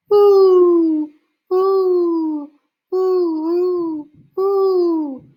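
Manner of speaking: high, loud hooted reply; owl call back. A narrator's reading. Father answering the owl, high loud hooting. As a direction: hoot back as if talking to the owl. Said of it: "Then he called back".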